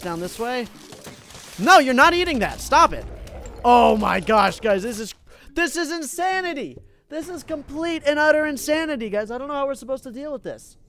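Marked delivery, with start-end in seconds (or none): 1.6-3.1 sudden loud high "No!"; alarmed yell. Real, not acted. Gamer yelling at a zombie eating his pumpkin, loud high. 3.6-10.8 high, fast exasperated gush; overwhelmed exclamation. Real, not acted. Streamer overwhelmed by the zombie wave, high exasperated rant.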